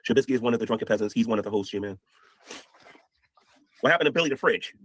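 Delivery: ordinary talk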